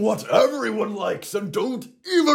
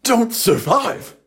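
ordinary talk

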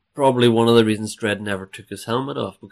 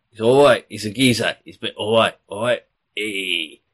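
Cockney geezer; 4 dB louder than usual. Acted. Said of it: "my Cockney".

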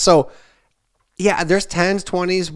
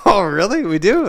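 higher, louder fake amazement; mock surprise; 7 semitones higher, 6 dB louder than usual. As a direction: play along like a surprised dad. Dad feigns surprise at daughter.

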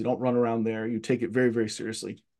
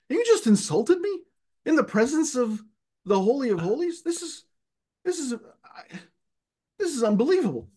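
high, fast, scandalized disbelief; much higher, fast, outraged kid protesting; indignant outrage; 8 semitones higher than usual. Retold or imitated. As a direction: act deeply offended by the priest's insult. Kid's indignant inner protest.

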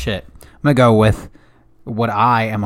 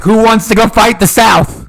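high, loud call to a crowd; rallying shout; 11 semitones higher, 7 dB louder than usual. Acted. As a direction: holler for recruits across a square, drawn out. Rallying recruits in town square.

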